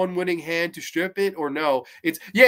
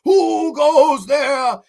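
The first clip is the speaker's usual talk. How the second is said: a slow, high, loud shout of who goes there; guard's challenge; 8 semitones higher, 8 dB louder, much slower than usual. Retold or imitated. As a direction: bellow it like a sentry at the gate. Guard challenging intruders in story.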